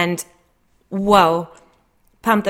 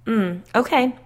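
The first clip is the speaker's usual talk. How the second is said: a higher, openly annoyed okay; open annoyance; 5 semitones higher than usual. A narrator's reading. Narrator unimpressed by the gossipy woman, openly annoyed. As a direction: let the irritation show in the okay. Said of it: "not bothering to hide my growing annoyance".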